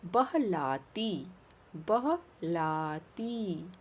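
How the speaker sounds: बहलाती drawn out very slowly; slow careful diction. Shown as a demonstration. Teacher drills बहलाती slowly for the class. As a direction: stretch each syllable of बहलाती. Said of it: "शब्दों पर ध्यान देना".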